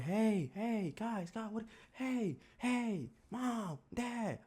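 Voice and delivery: put-on very high squeaky child voice. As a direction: squeak out the calls like a little kid. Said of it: "but in my squeaker voice".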